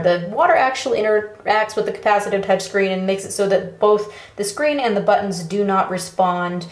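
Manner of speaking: ordinary talk, nothing marked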